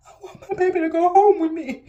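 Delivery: high, loud crying plea; tearful plea. Real, not acted. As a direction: cry out the refusal. Refusing to hold dead baby.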